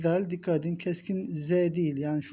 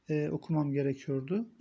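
usual speaking voice

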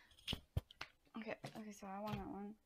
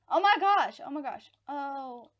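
loud, high gasp of alarm, easing on the repeat; real, startled shriek of words; shocked outcry; 4 semitones higher, 19 dB louder than usual. Real, not acted. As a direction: exclaim at full volume. Sudden turn in the game.

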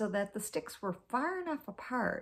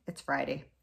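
ordinary delivery

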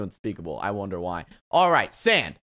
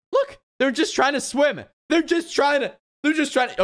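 pitched way up in pity; high pitying voice; 10 semitones higher than usual. Real, not acted. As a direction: coo over them, high and sorry. Pitying the drowning mobs.

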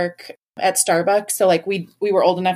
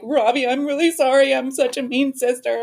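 higher-pitched mock tearful apology; lightly acted weepy confession; retold bawling; 5 semitones higher than usual. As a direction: imitate yourself blubbering an apology. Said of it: "So I'm literally bawling".

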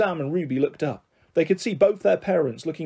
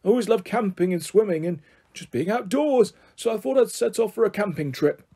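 lightly voiced eccentric old man, raised pitch; rambling grandpa; 5 semitones higher than usual. Grandpa tells children why he went camping, rambling. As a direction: ramble on earnestly about the outdoors. Grandpa character explains himself.

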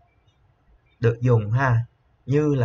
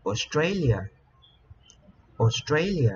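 Australia with a higher-pitched "STRA"; Australia said as os-TRAY-lia; stress on second syllable; 3 semitones higher, slower than usual. Shown as a demonstration. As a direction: punch the second syllable of Australia, then fall. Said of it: "Chúng ta sẽ nhấn âm thứ hai".

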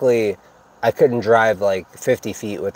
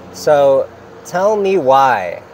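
slow and deliberate; slow emphasis; 4 semitones higher, 4 dB louder, much slower than usual. Real, not acted. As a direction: drag out the question for effect. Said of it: "slow rhetorical setup".

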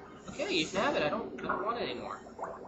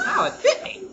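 loud laughing "ow"; laughing yelp; 11 semitones higher, 11 dB louder than usual. Real, not acted. Gamer bitten by a creature, laughing loud yelp.

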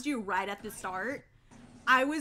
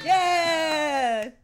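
excited cheer; 4 semitones higher, 7 dB louder than usual. Real, not acted. Cheering at round start.

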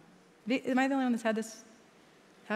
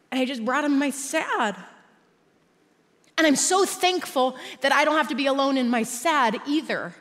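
loud, raised-pitch exhortation; impassioned preaching; 7 semitones higher, 8 dB louder than usual. Real, not acted. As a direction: preach with fervor, voice lifted and driving. Preaching at full force.

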